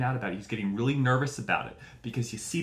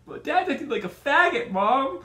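loud, high bratty shout; teenage son yelling at his mom; whiny kid yell; 13 semitones higher, 8 dB louder than usual. Retold or imitated. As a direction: screech it like a furious teenager.

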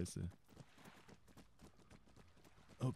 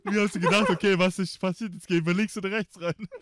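laughing; 10 semitones higher, 13 dB louder than usual. Real, not acted. Laughing at friend's move.

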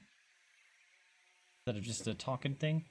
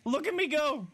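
high, gleeful cry; delighted squeal; 11 semitones higher than usual. Real, not acted. Player showing off the wombat running, delighted squeal. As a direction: show off with excited high voice.

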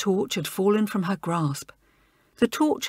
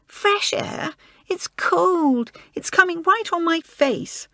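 squeaky; 9 semitones higher, slower than usual. Acted. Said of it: "her voice getting squeaky".

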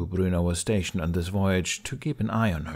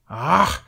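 sharp high frustrated yell; a short cry of despair, pulling at hair; 7 semitones higher, 6 dB louder than usual. A narrator's reading. Frustrated outburst, gripping hair.

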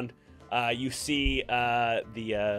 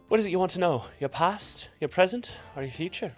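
mysterious, teasing questions; fortune teller; 6 semitones higher than usual. Acted. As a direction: draw them into the reading.

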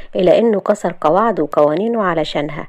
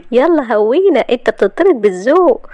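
higher, louder playful shooing; teasing dismissal; 6 semitones higher, 5 dB louder than usual. Acted. Shahd telling Kamal he's being politely thrown out, teasing. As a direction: shoo him off in a pitched-up voice.

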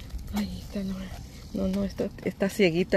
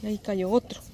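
ordinary delivery

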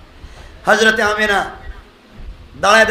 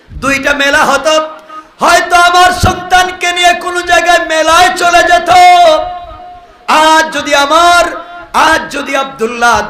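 high, loud lament, slowing at the end; full-force preaching; 8 semitones higher, 8 dB louder than usual. Acted. Preacher voicing Amina's longing in a high, loud, slowing cry.

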